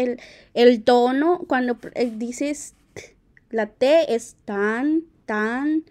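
ordinary delivery

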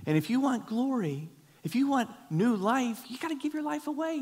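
high, rising preacher's call; fervent pulpit cry; impassioned preaching. Acted. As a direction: drive it home to the congregation. Preaching at full force.

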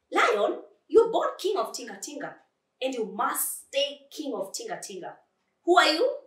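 high, emphatic challenge; rallying flea. Acted. Flea reminding the lion he's king, rousing. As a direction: build up a timid friend insistently.

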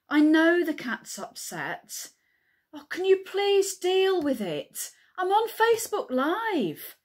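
high, loud, pleading complaint; exasperated calling out. Acted. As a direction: call to the next room, losing patience. Calls to Kyle during the livestream.

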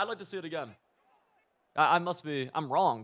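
ordinary talk

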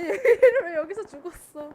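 loud, high laughing exclaim; laughing shout. Real, not acted. Laughing in chaotic fight.

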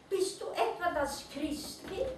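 high, small creature voice calling upward. A narrator's reading. Mole rat calls up to the light, high and hopeful. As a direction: call up to the treetop like a tiny animal. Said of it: "rief er nach oben".

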